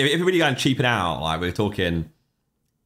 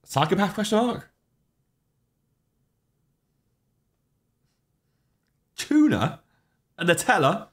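mock disbelief; 10 semitones higher than usual. Real, not acted. Disbelief at Nutella and tuna.

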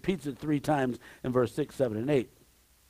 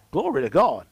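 normal talking voice

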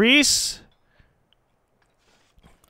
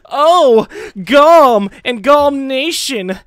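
high, loud mocking whine, crying out; mock whining enemy; 8 semitones higher, 7 dB louder, much slower than usual. Gamer mocks the rival leader's future defeat, whining high. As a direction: wail like a beaten loser. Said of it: "whining on the ground like".

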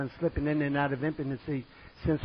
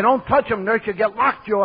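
loud, raised-pitch heckle; mock jeering; 6 semitones higher, 8 dB louder than usual. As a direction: imitate a crowd taunting him. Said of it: "And they're all laughing".

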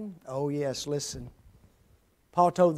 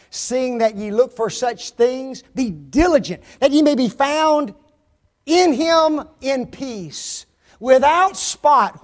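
raised, emphatic verse reading; preach; 6 semitones higher, 7 dB louder than usual. Real, not acted. Preacher reading the next verse to the church, forceful preaching. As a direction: proclaim the verse, building louder.

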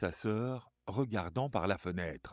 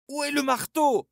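much higher, loud frantic shriek; desperate scream; 10 dB louder than usual. Acted. Suzanne screams from the door for the hammer. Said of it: "hurla Suzanne".

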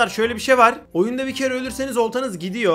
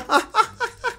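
high giggle. Real, not acted. Laughing at chat.

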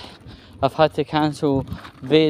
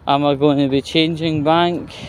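ordinary delivery

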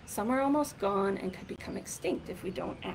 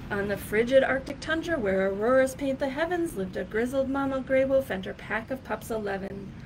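lively, sing-song picture-book reading; rhythmic, louder read-aloud storytelling voice; reading to children; 4 semitones higher, 5 dB louder than usual. Acted.

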